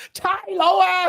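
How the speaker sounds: shrill hammed-up yelp of fear; mock panicked cry. Retelling a movie villain fleeing, mock scared cry. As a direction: squeal it like a fleeing crook. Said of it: "runs and goes".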